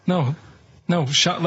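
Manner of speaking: normal talking voice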